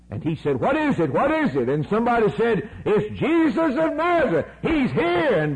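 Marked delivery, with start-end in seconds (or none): 0.6-1.7 high, eager repeated question; startled asking. Acted. Blind beggar hearing a crowd pass, high and eager. 2.9-5.4 high, ringing announcement; high excited news. Acted. Somebody in the crowd telling the blind man, high and excited.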